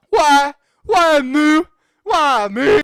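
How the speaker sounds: loud, high, hammed-up wailing; despairing cry. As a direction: wail at fate at full voice. Trapped character despairing in elevator.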